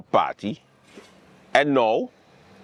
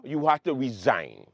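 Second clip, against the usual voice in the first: ordinary delivery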